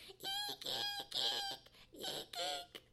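very high piercing bird screams; jay screaming eek. A narrator's reading. Jay screaming at fox, piercing high eek cries. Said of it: "began to scream at the top of his lungs".

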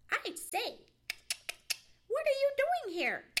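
high, loud, insistent demand; persistent squirrel. A narrator's reading. Squirrel demanding an answer from Johnny, shrilly persistent. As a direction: press the question in a high squirrel voice. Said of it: "persisted Chatterer the Red Squirrel".

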